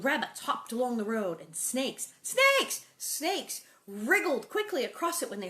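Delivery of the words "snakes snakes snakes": high excited squeal of snakes; thrilled outburst. Real, not acted. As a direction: jump up on the word snakes.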